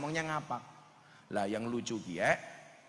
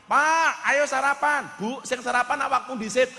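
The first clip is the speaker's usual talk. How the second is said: high, loud back-and-forth as husband and wife; acted couple quarrel; 10 semitones higher, 7 dB louder than usual. Husband and wife bickering at breakfast, shouted high. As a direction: throw the lines across like a bickering couple.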